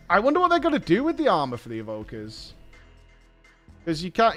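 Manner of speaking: ordinary delivery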